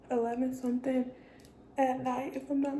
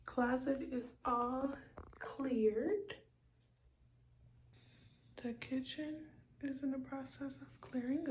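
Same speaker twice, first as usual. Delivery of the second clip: whispering; 9 dB quieter than usual. Real, not acted. Whispered late-night update.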